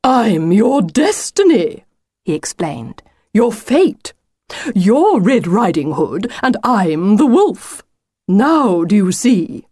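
slow, weighty wolf voice; self-important wolf, explaining. Acted. Wolf telling Lucy he is her destiny, grandly explaining. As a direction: spell it out grandly, stretching the key words.

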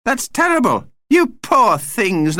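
high, aghast vampire pity; horrified. A narrator's reading. Vampire pities the family bound for Australia, horrified. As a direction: pitch it up and draw out the dismay. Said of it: "looking horrified".

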